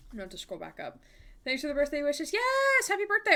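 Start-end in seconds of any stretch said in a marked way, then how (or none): 2.3-3.3 excited. Real, not acted. Cheering chatter birthday.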